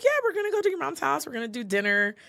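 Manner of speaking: high, fast, bubbly; breezy high-pitched excitement, quickly; excited announcement. Retold or imitated. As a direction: chirp it out like exciting news. Cheerfully announcing surprise visit to husband.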